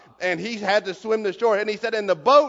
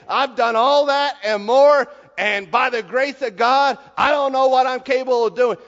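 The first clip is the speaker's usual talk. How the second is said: raised, loud heartfelt admission; fervent confession; 4 semitones higher, 6 dB louder than usual. Retold or imitated. A believer confessing his sin with rising fervor. Preacher voicing humble confession.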